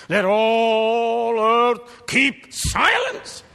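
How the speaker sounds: slow, loud and high; solemn proclamation. Acted. Preacher solemnly orders the whole earth to keep silence. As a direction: proclaim it slowly from the pulpit.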